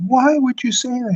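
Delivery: high, wounded whine; lightly faked tearful protest; mock hurt. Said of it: "She was like".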